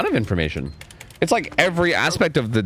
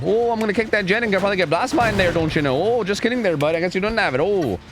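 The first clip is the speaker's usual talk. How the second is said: put-on playful hokey Canadian accent, higher, with eh and bud; mock Canadian taunt; 6 semitones higher than usual. Killer streamer taunting a survivor in a mock Canadian accent. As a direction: tease the survivor in a jokey Canadian voice.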